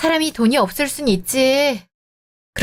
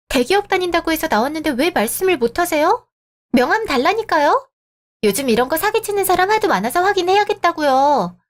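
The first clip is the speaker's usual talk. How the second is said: higher, insistent exasperated demand; irritated demanding; 6 semitones higher than usual. Acted. Woman on a blind date demanding proof of his job, higher and exasperated. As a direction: press him hard, fed up.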